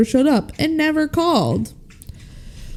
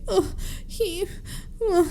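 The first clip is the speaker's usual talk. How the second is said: high, very soft mock whimper; imitated crying; 10 semitones higher, 9 dB quieter than usual. Retelling Stephanie's tearful phone call, in a faint mock cry. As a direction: falter softly as if tearful. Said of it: "and she's crying".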